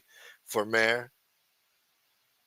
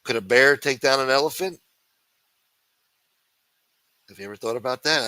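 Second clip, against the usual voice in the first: normal talking voice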